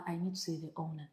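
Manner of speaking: quiet, quick, calm ask; calm talking for contrast. Retold or imitated. The host showing how the guest did not talk, quietly. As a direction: say it softly, as she did not say it. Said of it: "She's not just talking like".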